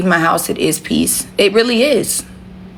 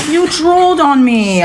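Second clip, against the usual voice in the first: pet-talk scolding; 9 semitones higher, 4 dB louder, slower than usual. Real, not acted. Repeating to her pet, more insistent.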